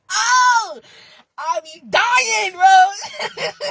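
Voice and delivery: high, loud, drawn-out shout breaking into laughter; hysterical delight. Real, not acted. Fan raving about hilarious gaming videos, hysterical delight. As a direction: shout it as you crack up.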